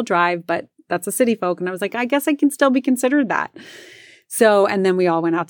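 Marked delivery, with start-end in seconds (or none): 1.9-3.5 high-pitched, conceding reply; retold concession. Driver accepting the city folk label, high-pitched concession. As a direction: give in with the voice pitched up.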